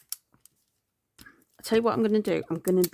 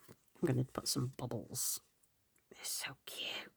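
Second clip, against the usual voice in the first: soft whisper of delight; 5 semitones lower, 11 dB quieter than usual. Real, not acted. Admiring cute bubble stickers in her planner, whispered. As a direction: breathe it out over the stickers.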